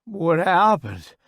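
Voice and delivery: hoarse croak on waking; croaky. Acted. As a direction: rasp it out groggily. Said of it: "I croak".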